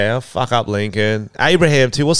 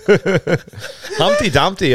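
laughing; 8 semitones higher, much slower than usual. Real, not acted. Laughing at Humpty Dumpty idea.

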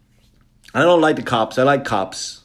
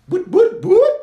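high, loud imitated police siren whoop; 7 dB louder than usual. Comic imitates the police car pulling him over with a siren.

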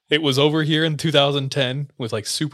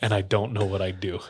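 brooding low voice; 4 semitones lower, 6 dB quieter than usual. Retold or imitated. Upset missionary kid confesses his temper to the teller, subdued. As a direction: retell it as a subdued, halting confession.

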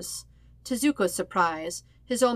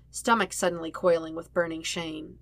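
usual speaking voice

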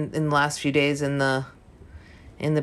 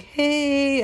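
much higher, cautious little hey; tiptoeing hello; 11 semitones higher than usual. Retold or imitated. Mike walks in on her crying, tiptoeing hey. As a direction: greet her gingerly like she might break. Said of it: "Don't tiptoe around me".

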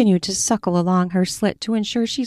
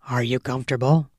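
low, commanding male voice; low dominant man; 6 semitones lower, faster than usual. Acted. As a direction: drop low and take control. Male lover asks.

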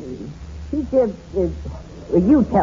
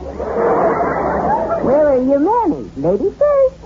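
christmas elf; 12 semitones higher, much slower than usual. Acted. Man voicing elf for child.

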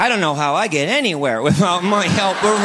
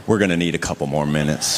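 deep, flat aside; 14 semitones lower than usual. Retold or imitated. Husband's aside to the waiter.

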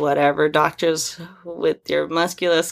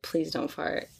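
whispering; 12 dB quieter than usual. Retold or imitated. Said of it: "that's one where I'm like".

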